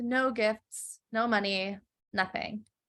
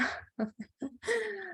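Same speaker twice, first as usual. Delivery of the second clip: high laugh; 10 semitones higher, 10 dB quieter than usual. Real, not acted. Laughing at her own joke.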